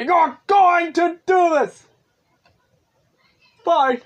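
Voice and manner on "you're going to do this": loud frustrated shout; yelling. Real, not acted. Yelling at the little monstrosity, loud and fed up.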